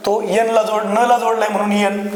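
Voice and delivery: usual speaking voice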